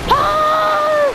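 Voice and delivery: sudden high yelp; frustrated shriek. Real, not acted. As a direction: cry out in frustration. Game won't load.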